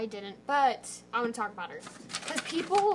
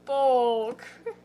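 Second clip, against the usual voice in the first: put-on movie quote in British accent; 8 dB louder than usual. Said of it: "Do that movie".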